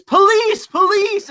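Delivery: high squealing mock plea; mock cry for police. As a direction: shriek for the cops in parody. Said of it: "your immediate reaction is".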